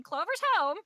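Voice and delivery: high, loud, chirpy squawk; old master imitating the bird's cry, high and loud; parroting bird. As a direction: squawk it like an excited little bird. Bird repeating a phrase.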